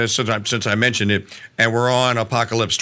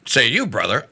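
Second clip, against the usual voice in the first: ordinary talk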